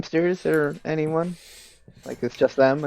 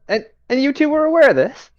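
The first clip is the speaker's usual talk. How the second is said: accusatory outrage; 12 semitones higher than usual. Acted. Accusing the party members.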